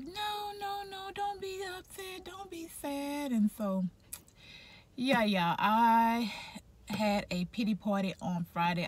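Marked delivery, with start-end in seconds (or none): 0.0-3.3 high, much softer, gentle reassurance; soothing friend. Retold or imitated. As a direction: coo it like comforting a sad friend. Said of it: "she was like".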